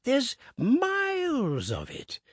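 put-on high, slow quavering old voice; old Woodifield quavering about the cemetery. A narrator's reading. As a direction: let the old voice tremble upward. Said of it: "quavered old Woodifield".

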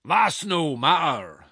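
loud, slow growl pitched high; giant's growl. A narrator's reading. Giant brushing off the woman's question, growling loudly. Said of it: "growled the giant".

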